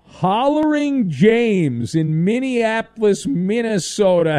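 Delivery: drawn-out, booming showman intro; big, slow, high-pitched welcome; announcer-style caller intro. Real, not acted. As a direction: stretch the name out like a ring announcer. Host introducing caller on air.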